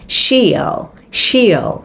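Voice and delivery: "she'll" as one syllable "shil"; contracted she'll. Shown as a demonstration. Teacher drills the contraction for learners. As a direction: clip "she will" to "she'll". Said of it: "viết lược đi".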